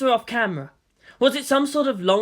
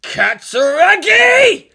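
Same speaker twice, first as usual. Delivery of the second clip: very loud, high, drawn-out furious scream; angry scream; 11 semitones higher, 10 dB louder, much slower than usual. Acted. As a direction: scream her name in rage. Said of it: "President Jacobi screamed angrily".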